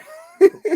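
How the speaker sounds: sudden high burst of laughter; loud laugh burst. Real, not acted. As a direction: crack up at your own joke. Laughs at own joke.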